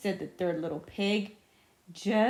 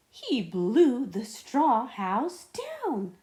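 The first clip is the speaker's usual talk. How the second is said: dramatic narration; 3 semitones higher, 4 dB louder, slower than usual. A narrator's reading. Dramatic narration after sneeze.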